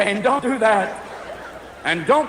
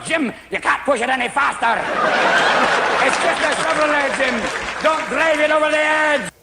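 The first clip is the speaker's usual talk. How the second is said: Scotty voice; 4 semitones higher than usual. Retold or imitated. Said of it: "Scotty's sitting next to you going".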